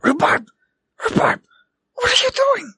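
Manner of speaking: high, urgent stage-whisper shout; hiss-yell his name to get his attention. Acted. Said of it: "He's just gonna kinda do the whisper yell".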